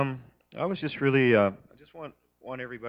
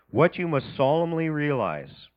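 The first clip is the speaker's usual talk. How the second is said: solemn scripture reading; 4 semitones higher, 5 dB louder than usual. Real, not acted. Reading scripture aloud to congregation.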